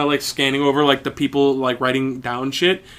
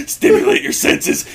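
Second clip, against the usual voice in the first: mock shout; 4 dB louder than usual. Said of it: "you need to stimulate their senses".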